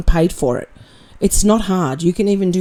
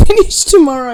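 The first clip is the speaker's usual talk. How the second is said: laughing; 6 semitones higher, 6 dB louder than usual. Real, not acted. Laughs mid line.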